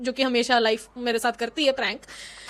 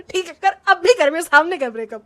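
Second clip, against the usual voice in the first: defiant dare; 2 semitones higher, 5 dB louder than usual. Retold or imitated. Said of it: "ऐसे मैं बोली".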